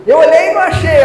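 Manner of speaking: husband calling out in frustration over a lost key; mock frustrated shout. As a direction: complain to your wife that the key is gone. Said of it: "Eu falo assim".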